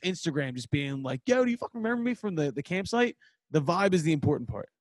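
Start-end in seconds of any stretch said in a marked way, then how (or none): 1.3-3.2 high, fast, hyped-up shout-out; excited fan. Retold or imitated. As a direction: rattle it off like an eager fan. Fans messaging the band.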